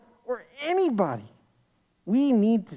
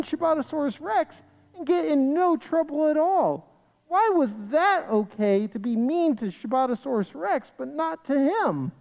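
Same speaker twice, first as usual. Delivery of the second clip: higher-pitched, indignant questioning; mild disbelief; 7 semitones higher than usual. A narrator's reading. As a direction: voice a child's disbelief at unfairness. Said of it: "David couldn't believe his ears".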